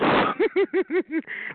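long, high rippling laugh; taunting cackle. Real, not acted. Daring the caller to scream, high taunting laugh. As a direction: cackle high after the dare.

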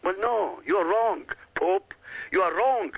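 normal talking voice

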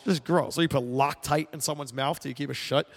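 normal talking voice

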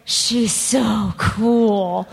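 very slow, drawn-out awe; savoring each word, starstruck; awestruck admiration. Retold or imitated. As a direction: stretch the words out in admiration. Retold awe at watching the originals.

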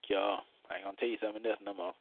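normal talking voice

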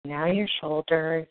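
slow, soft soothing meditation voice; guided meditation. Acted. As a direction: ease them gently into calm.

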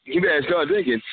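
usual speaking voice

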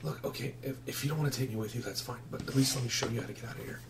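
hushed, near-whispered plea; soft secretive boy; furtive runaway boy. Acted. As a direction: keep it very quiet, barely voiced.

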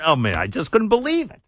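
ordinary delivery

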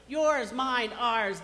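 ordinary delivery

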